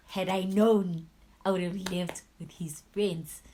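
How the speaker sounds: slow and loud at first, then easing off; loud regret. Real, not acted. Telling viewers she would have left, loud and bitter. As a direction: spit out the regret slowly. Regret building over the night.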